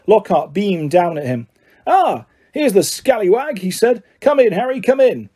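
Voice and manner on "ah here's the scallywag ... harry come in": much higher, jovial welcome; beaming host. A narrator's reading. Lockhart welcomes Harry to detention, beaming. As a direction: greet him like an adoring fan.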